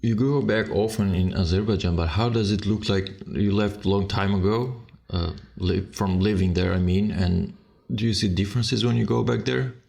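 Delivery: normal talking voice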